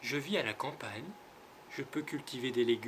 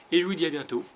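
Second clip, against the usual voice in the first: loud, higher farewell; cheerful goodbye; 5 semitones higher, 11 dB louder, faster than usual. Real, not acted. Teacher to pupils, a loud cheerful farewell. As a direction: call out a warm goodbye. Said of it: "goodbye to pupils".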